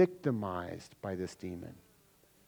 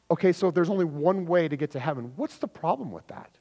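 normal talking voice

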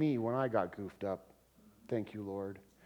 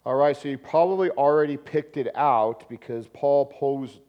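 usual speaking voice

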